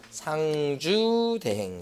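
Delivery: very slow, stretched syllables; drawn-out memory drill. Shown as a demonstration. Instructor drilling a mnemonic into exam students, drawn-out. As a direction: stretch the keyword out like a chant.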